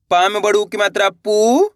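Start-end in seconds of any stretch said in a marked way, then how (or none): 1.1-1.7 पू held long, gliding up; stretched vowel. Shown as a demonstration. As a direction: stretch the ऊ in पू upward. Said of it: "तो खींच के पढ़ना है".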